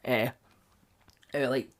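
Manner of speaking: ordinary delivery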